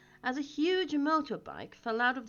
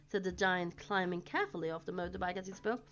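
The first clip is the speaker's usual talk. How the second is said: plain reading voice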